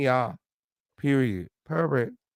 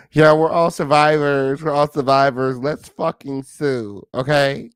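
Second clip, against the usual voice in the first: slow, drawn-out mock battle cry; mocking rally; 5 dB louder, slower than usual. Mimicking the passengers' survivor talk, slow mocking rally. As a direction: stretch it out as a sarcastic rallying call.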